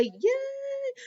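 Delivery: high squeal of joy; excited cheer. Real, not acted. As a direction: cheer it up high, soft and gleeful. Cheering that it is Friday.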